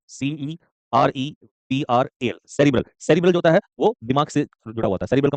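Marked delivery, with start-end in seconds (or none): 0.1-2.4 slowly spelled letter by letter; spelling out. Shown as a demonstration. Teacher spells the word for students, slowly letter by letter. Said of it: "इसको हम लोग ये भी कह सकते हैं सेरिब्रल".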